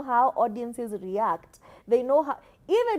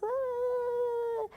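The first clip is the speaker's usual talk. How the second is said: small high whimper of a voice; mock timid voice; 11 semitones higher, 6 dB quieter than usual. Mocking a speaker with no confidence, timid. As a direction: shrink into a tiny weak sound. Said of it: "your delivery is just".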